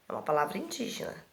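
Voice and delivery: normal talking voice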